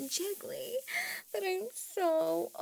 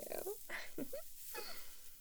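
high giggle; 10 semitones higher, 11 dB quieter than usual. Acted. Giggles after teasing question.

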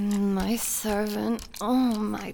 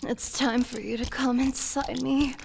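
normal talking voice

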